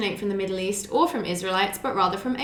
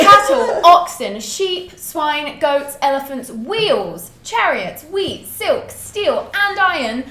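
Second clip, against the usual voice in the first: indignant list; 4 semitones higher, 7 dB louder, much slower than usual. Real, not acted. Listing anachronisms with indignation.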